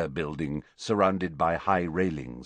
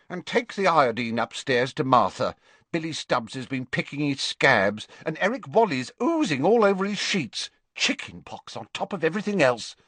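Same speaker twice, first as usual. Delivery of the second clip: higher-pitched, fussing list of troubles; harassed matron; 6 semitones higher than usual. Acted. Matron ordering a helper about sick children, harassed. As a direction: rattle off complaints to nobody in particular.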